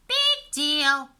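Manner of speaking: dismissive. A narrator's reading. Beatrice voiced by narrator.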